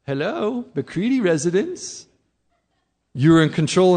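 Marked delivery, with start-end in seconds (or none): 0.0-2.1 switches to a bright, polite phone greeting; sweet as honey the moment the phone rings; sudden polite phone voice. Retold or imitated. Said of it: "the phone rings and you go".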